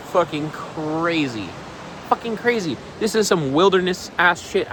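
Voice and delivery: ordinary talk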